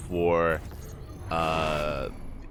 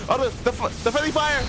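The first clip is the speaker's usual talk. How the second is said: shocked exclamation; 14 semitones higher than usual. Real, not acted. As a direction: yelp it out in alarm. Caster reacts to friendly fire.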